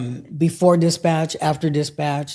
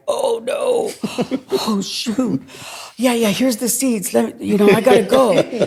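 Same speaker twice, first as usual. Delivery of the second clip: high, flustered and caught out, laughing it off; flustered panic; 6 semitones higher than usual. Retold or imitated. To the boss who overheard, high flustered panic. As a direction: babble nervously like you've been caught.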